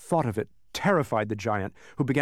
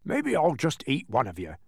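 ordinary delivery, nothing marked

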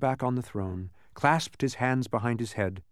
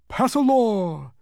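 high, loud, drawn-out bug; bright suggestion; 13 semitones higher, 7 dB louder, slower than usual. A narrator's reading. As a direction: pipe up eagerly. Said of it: "the humbug suggested brightly".

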